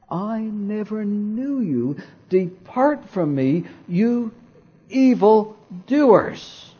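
stern Jesus. Acted. Preacher voicing Jesus rejecting evildoers, slow and stern. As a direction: pronounce judgment slowly as Jesus.

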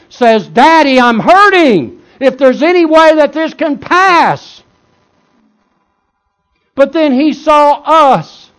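building to a high, sustained shout; full-force preaching. Acted. Preacher voices Jesus hurting before his Father, climactic shouted preaching.

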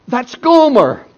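high, loud cry of her name; anguished cry. Acted. Hosea crying out as his lost wife stands on the block, loud and high.

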